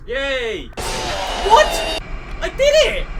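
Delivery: very high gleeful shout; excited cheer. Real, not acted. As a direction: shriek with joy at winning. Beating a level.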